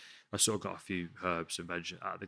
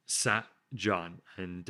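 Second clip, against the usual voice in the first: usual speaking voice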